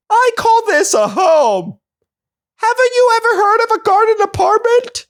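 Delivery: very high, indignant cartoon bear; sassy bear. Bear defending its crawl space home, indignant and squeaky. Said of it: "The bear was like".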